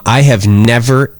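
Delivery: usual speaking voice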